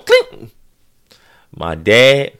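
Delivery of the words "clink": high squeaky clink sound; imitated clink. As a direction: make the machine's clink with your voice.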